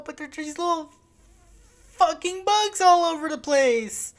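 high-pitched whine building to a loud yell; exasperated outburst. Real, not acted. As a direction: voice climbs sharply in frustration. Complaining about swarming bugs.

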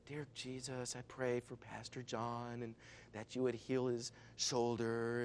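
slow, soft, sweet prayer. Retold or imitated. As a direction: pray it softly and sweetly, unhurried. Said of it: "real sweet".

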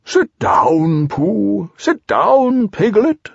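high, swooping owl voice; Owl, kindly. A narrator's reading. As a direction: welcome guests as a kindly storybook owl. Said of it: "said Owl kindly".

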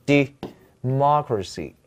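"democracy" slowly, stress on MOC; stress on second syllable. Shown as a demonstration. Teacher shows students where the stress falls in democracy, stressed second syllable. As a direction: split off the first syllable, then land on MOC in "democracy". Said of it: "关键是大家看到这个重音的位置".

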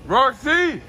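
loud, high scolding call; sharp call. Real, not acted. Owner scolding Roxy, loud sharp call. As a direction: shout her name to stop her.

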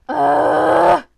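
loud, long exasperated groan. Real, not acted. Vlogger fed up with setup steps, groaning loudly. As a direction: groan it out at full volume.

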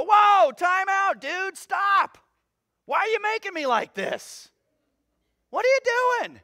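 high, loud comic protest; protesting lump of clay. Acted. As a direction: object like outraged clay to its potter. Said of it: "does that lump of clay ... have".

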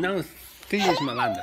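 squeaky high mock scold. Dad teasing his naughty toddler, in a high mock scold. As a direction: tease her with a squeaky little telling-off.

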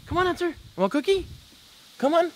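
very high sing-song coax. Real, not acted. Coaxing dog Hunter with a cookie, very high sing-song. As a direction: lure the dog with a treat.

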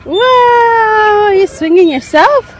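high, loud, drawn-out amazement; thrilled cheering. Real, not acted. Mom amazed toddler swings alone, thrilled and high.

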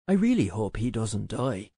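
low-pitched pout. A narrator's reading. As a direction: pout as you say it. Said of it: "He pouted".

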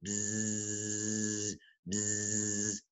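soft buzzing hum like a bee. Shown as a demonstration. Grandpa buzzes for the grandkids, soft bee buzz. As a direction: buzz it softly through the lips. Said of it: "Can you go buzz, buzz like a bee?".

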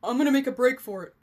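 loud, rushed blurt; hurried, loud panic before bolting; panicked. A narrator's reading. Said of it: "Lawrence's voice grew more panicked".

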